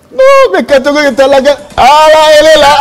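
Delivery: high, loud thrilled announcing; real live excitement; excited reveal. Real, not acted. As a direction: burst out as the winner appears. Host revealing the winner live.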